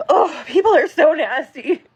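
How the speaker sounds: high, slow, tearful wail; real sobbing cry. Real, not acted. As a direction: lament through sobs. Crying lament.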